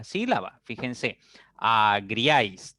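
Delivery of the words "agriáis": "agriáis" drawn out slowly, syllable by syllable; split into syllables. Shown as a demonstration. Teacher shows students the two syllables, "agriáis" split slowly. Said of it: "tengo dos sílabas".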